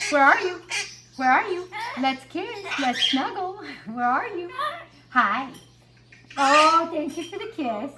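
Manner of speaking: a hint of a cuddly parrot asking for kisses; voicing her parrot. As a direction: voice a clingy bird begging for snuggles. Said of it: "Emerald has Emerald vision".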